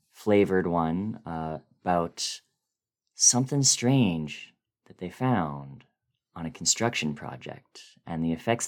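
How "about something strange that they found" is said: plain reading voice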